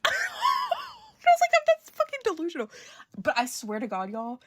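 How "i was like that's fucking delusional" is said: high, laughing yelp, much higher; laughing shout. Real, not acted. Mocking her own fan delusion with a laughing high yelp. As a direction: crack up at your own delusion.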